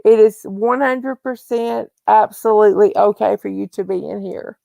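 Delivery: ordinary delivery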